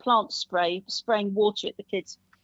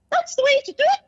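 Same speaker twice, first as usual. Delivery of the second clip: squeaky high Punch voice, loud; 6 dB louder than usual. Shown as a demonstration. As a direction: shriek it like Mr Punch. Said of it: "I can do some of the voices now".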